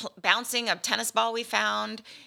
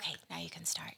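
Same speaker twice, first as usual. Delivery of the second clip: hushed whispered cue; 5 semitones lower, 14 dB quieter, faster than usual. Pretend. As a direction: whisper the go-ahead softly. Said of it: "wait, shh".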